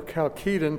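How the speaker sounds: Chalcedon as kal-KEE-dun, hard k and long ee; third pronunciation variant. Shown as a demonstration. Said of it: "three different ways".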